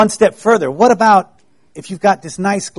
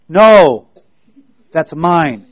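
selfish refusal; 6 dB louder, much slower than usual. Pretend. Selfish child refuses to share.

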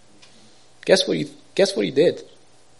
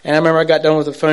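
normal talking voice

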